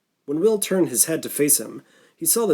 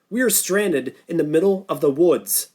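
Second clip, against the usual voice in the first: a raised, firm command voice; 4 semitones higher, 3 dB louder than usual. Acted. Norton reminding the group they are stranded, commanding. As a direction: lay down the facts with authority. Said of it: "his voice suddenly sounding commanding".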